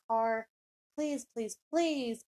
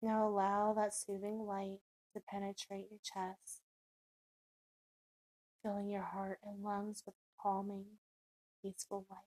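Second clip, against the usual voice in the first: guided meditation; 7 dB quieter than usual. Acted. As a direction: ease the listener down into calm, unhurried.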